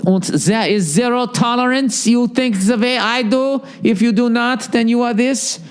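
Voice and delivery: high, stiff put-on accent; mock zealot. Mocking Hollywood and Fox types as rigid zealots, high put-on accent. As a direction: bark it like a zero-tolerance zealot. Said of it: "they sound just like the Fox News right people".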